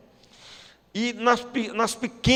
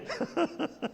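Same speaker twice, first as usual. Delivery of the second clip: airy laugh; 12 semitones higher, 10 dB quieter than usual. Real, not acted. Laughs at own joke.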